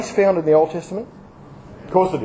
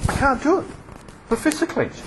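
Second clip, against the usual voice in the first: higher, softer mock helpless whine; mock frustration; 8 semitones higher, 6 dB quieter than usual. Preacher acting helpless before the congregation, mock whine. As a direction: play a man straining with fists clenched. Acting out trying with clenched fists.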